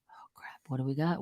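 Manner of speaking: hushed plotting whisper; mock panicked hush; conspiratorial whisper. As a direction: whisper like a schemer caught out. Secret planners panicking.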